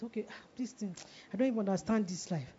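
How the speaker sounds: very soft, quick grumble; acted weariness. Preacher replaying being down about life, quietly weary. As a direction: grumble to yourself under your breath. Acting out morning discouragement.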